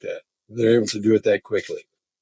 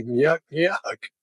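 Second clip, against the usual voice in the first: Curly nyuk nyuk; 6 semitones higher than usual. Retold or imitated. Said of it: "like Curly from the Three Stooges".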